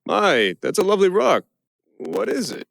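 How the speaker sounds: high, gushing, put-on interest; suddenly high and over-eager; blurted admiration. A narrator's reading. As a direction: blurt out a compliment to distract. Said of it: "blurted the first thing".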